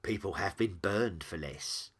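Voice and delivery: slow, ominous demon voice; smugly foreboding, unhurried demon; relishing prophecy. Acted. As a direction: draw the warning out with relish. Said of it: "warming to its prophecy".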